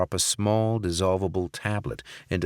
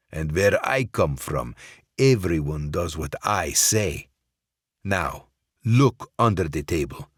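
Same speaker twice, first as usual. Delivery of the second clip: cold Russian boss. Acted. Sokolov threatening Barrett with a pistol, menacing. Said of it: "the Russian said".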